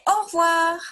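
put-on teacher modelling au revoir in a high voice for children; French au revoir, high. Shown as a demonstration. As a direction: model au revoir brightly for the class. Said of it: "when we leave, we say".

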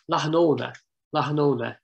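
Ona opened with a long "oh"; local pronunciation. Shown as a demonstration. Lecturer showing how locals say the field name, local pronunciation. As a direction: Lochan Ona said the local way, "oh-na", Lochan softened. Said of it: "pronounced locally".

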